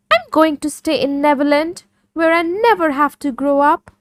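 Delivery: put-on a cheerful young boy refusing to grow up; boy Peter's reply. Peter answers the Darlings.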